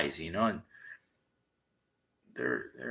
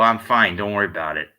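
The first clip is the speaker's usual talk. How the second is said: loud, clipped retort; curt loud brush-off; 12 dB louder than usual. Retold or imitated. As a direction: shut him down hard and loud. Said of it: "and I go".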